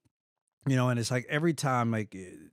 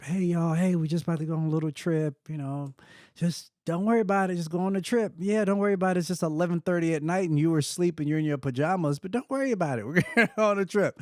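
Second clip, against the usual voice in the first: higher-pitched, lilting put-on reassurance; dad reassuring kids; 5 semitones higher, faster than usual. Dad waking the kids for a late evacuation, mock-casual reassurance. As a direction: play down the panic in a high, casual voice. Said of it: "I'm waking the kids up".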